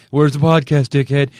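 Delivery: punchy mock outrage; mock angry demand. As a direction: heckle like an impatient fan. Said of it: "they get mad".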